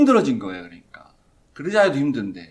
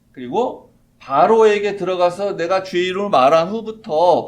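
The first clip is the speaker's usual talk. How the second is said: lamenting; 3 semitones higher, 10 dB louder than usual. Retold or imitated. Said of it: "이렇게 한탄을 하는 건데".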